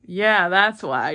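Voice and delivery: slow, drawn-out sarcastic yeah; sarcastic agreement. Retold or imitated. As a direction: agree with heavy, mocking slowness.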